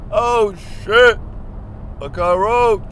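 high, loud yell of alarm; panicked mock shout; alarmed cry. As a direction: cry out as if spotting a roach. Acts out spotting a cockroach.

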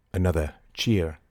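plain reading voice

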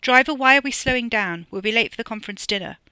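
loud, high, imperious demand; bossy, carrying complaint. A narrator's reading. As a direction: call out over the others to the driver. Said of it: "Freddie raised his voice".

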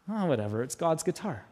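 soft, offhand shrug of a line; unbothered. Retold or imitated. Pastor recalls shrugging off his dented guitar, unbothered. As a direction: brush it off quietly, no anger. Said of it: "I didn't get angry".

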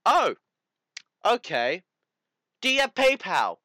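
imitating himself jumping at the money offer; mock eager excitement. Retelling his keen reply to the sugar mommy, hammed-up eager yelp.

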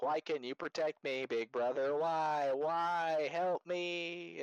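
high, whining mock wail; mock-tearful cry for help from big brother; imitated crying plea. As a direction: plead like a desperate little sister, hammed up. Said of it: "as she's crying".

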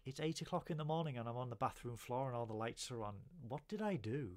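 confused morning-after ramble; bewildered hungover. Retold or imitated. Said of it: "And I'm like".

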